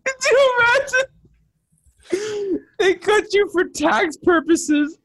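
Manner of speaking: very high, wheezing laughing speech; helpless laughter. Real, not acted. Riffing on Melo being cut, helplessly laughing. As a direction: squeal the words through uncontrollable laughter. Cracking up at joke about cutting Melo.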